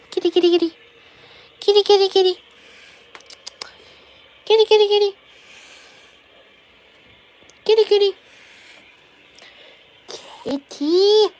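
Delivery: high, loud "kitty, kitty, kitty" call; calling the cat. Real, not acted. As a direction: call the cat over, rising in excitement.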